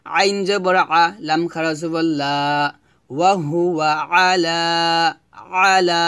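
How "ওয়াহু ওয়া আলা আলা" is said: "আলা" with a held final "laaa"; lengthened "laa". Shown as a demonstration. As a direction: say "আলা" from the throat to a long second vowel. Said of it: "লাতে এক লিপ টান হবে".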